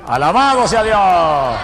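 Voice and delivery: slow, drawn-out loud cry of praise; shouted praise. Real, not acted. Preacher to the congregation, a slow shouted praise. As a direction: bellow it out to the church.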